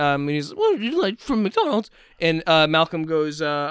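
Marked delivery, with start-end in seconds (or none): 0.5-1.9 lightly voiced nerd, higher; a hint of a nerdy cashier.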